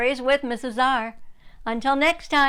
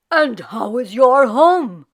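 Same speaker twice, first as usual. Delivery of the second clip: put-on louder, slow, kindly old rabbi greeting; friendly old rabbi voice; 9 dB louder than usual. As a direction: lean in as a smiling rabbi. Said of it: "the rabbi smiling down at her".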